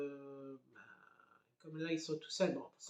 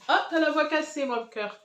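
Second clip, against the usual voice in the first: much higher, louder tender coo; 11 semitones higher, 9 dB louder than usual. Real, not acted. Mother cooing over her daughter's hoarse voice, high tender.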